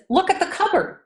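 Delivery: high-pitched mock exasperation; daughter's exasperation. Retold exasperated daughter to her mother.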